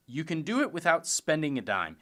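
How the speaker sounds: ordinary delivery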